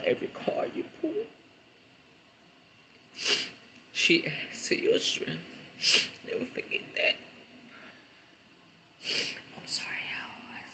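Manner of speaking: breaking into a near-silent whisper; words barely breathed out, overcome. Real, not acted. Overcome while reading the message.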